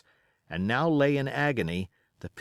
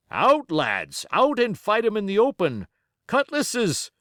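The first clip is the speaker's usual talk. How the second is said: shouted order, much higher and louder; rallying cry; 9 semitones higher, 5 dB louder than usual. A narrator's reading. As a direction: call the men out to fight. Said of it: "cried the captain".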